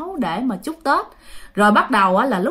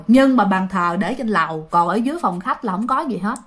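ordinary talk, nothing marked

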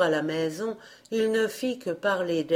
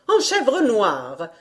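stepmother's order; 4 semitones higher, 5 dB louder, slower than usual. A narrator's reading. Stepmother answers the fairy.

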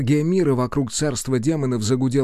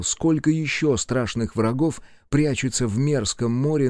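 ordinary delivery

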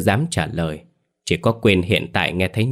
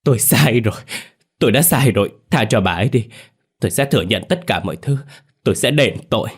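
high, loud, crying out in remorse; desperate wail; 4 semitones higher, 4 dB louder, faster than usual. Acted. Man begging the ghost to spare his wife, wailing loudly. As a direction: scream out the confession with eyes shut. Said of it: "nhắm tịt mắt mà gào lên".

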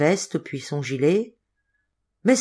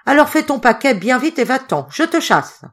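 high, loud order to get out; angry dismissal; 7 semitones higher, 5 dB louder than usual. A narrator's reading. Hotelier sacking the boy, angry dismissal. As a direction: throw him out with raised voice.

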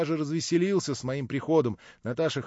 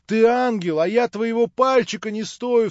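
much higher and louder, rapturous and remorseful; rapturous outcry; 9 semitones higher, 8 dB louder than usual. A narrator's reading. Alyosha to Natasha, overcome with rapture and remorse, crying out. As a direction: cry out in delight and remorse. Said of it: "вскричал Алёша с восторгом и с раскаянием".

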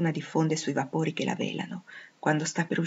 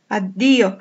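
loud, falling cry of farewell; narrated outcry, full voice; heartfelt cry; 2 semitones higher, 8 dB louder than usual. A narrator's reading. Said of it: "gridò il mio cuore".

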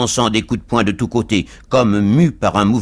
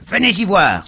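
loud, high fighting challenge; cab driver's cry; 10 semitones higher, 5 dB louder than usual. A narrator's reading. Cab driver still throwing punches, crying out. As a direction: cry out a dare, pitched up. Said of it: "s'écria le cocher de fiacre".

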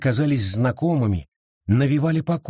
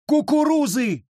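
far higher desperate scream; high, desperate wail; 13 semitones higher than usual. A narrator's reading. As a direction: wail the word out. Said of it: "завопила Абита".

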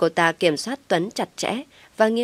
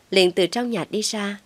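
usual speaking voice

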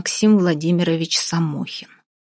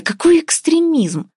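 shouted; 11 semitones higher, 4 dB louder, slower than usual. Acted. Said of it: "закричала Вася".

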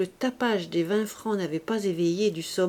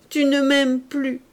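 rising tearful plea; 6 semitones higher, 7 dB louder, slower than usual. A narrator's reading. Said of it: "ses yeux s'emplirent de larmes".

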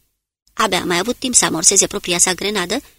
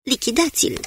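mild yell; 5 semitones higher than usual. A narrator's reading. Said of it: "Smith zbieră".

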